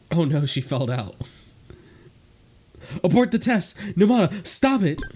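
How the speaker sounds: high, loud alarmed command; panicked shout. Acted. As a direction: yell to stop the test. Character panics, orders test stop.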